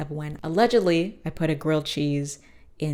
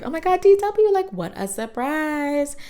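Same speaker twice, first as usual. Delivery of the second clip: put-on much higher, louder squeal of a sweet old grandma; surprised grandma; 11 semitones higher, 6 dB louder than usual. Grandma Thora greets D W, a high surprised squeal. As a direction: gush like a delighted granny at the door.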